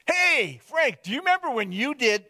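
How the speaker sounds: high-pitched call to Frank; hometown person calling out. Acted. Townspeople still reminding Frank of his past, high calling voice. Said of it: "people will still say to me".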